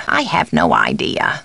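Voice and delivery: low, falling admission; subdued take. Acted. Housekeeper has no answer, low and subdued. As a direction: drop the voice low throughout. Alternate takes of stock line.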